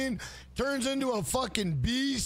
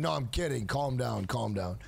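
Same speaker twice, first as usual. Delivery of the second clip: ordinary talk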